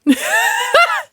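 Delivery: squealing burst of laughter; bright high laugh. Real, not acted. Laughing at Duh.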